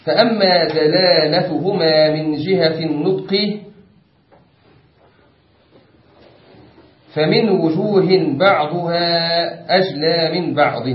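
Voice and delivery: slow, elongated classical recitation; formal text reading. Acted. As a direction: draw out each phrase of the text. Reads the book's text aloud. (قراءة المتن بتمهّل ومدّ)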